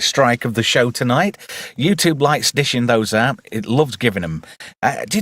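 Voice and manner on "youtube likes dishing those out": ordinary delivery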